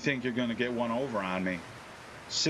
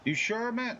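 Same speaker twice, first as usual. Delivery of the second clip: higher pushy hustler question; gang member; 8 semitones higher than usual. Retold or imitated. Gang member pushing a stranger to buy, higher swagger. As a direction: press him once more, street style. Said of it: "gang members like".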